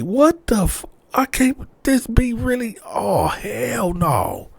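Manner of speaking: high, shouted, fed-up "oh hell no"; stunned he's been left with the baby; high outraged disbelief. Retold or imitated. Boyfriend left holding the baby at work, shouting high in disbelief.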